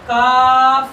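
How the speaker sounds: "کاف" with a light k and open long "aa"; loud, held teacher's model of "کاف", not rounded; thin, light sound. Shown as a demonstration. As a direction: keep the vowel of "کاف" thin, said loudly. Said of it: "یہ باریک کرو".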